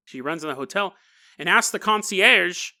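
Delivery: ordinary delivery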